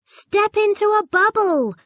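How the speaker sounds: high, loud call to the others; yelled. A narrator's reading. Hong calling his friends into the bubbles. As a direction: shout it out to the group. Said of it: "yelled Hong".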